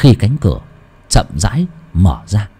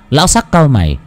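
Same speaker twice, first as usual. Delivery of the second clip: usual speaking voice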